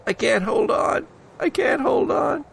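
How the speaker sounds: rising to a panicked high shout; a slipping climber screaming she can't hold on; desperate cry. Acted. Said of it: "Allison says".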